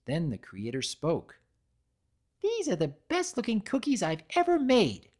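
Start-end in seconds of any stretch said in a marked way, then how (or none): 2.4-5.1 high, bubbly cartoon pony voice; Pinkie Pie voice. Pinkie admiring her fresh cookies, high and bubbly. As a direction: chirp it out like an excited pony. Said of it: "the Creator said".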